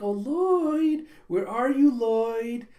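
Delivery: high, loud swooning lament; lovesick calling. Acted. Becky mooning over Lloyd, high loud lament. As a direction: pine for him theatrically.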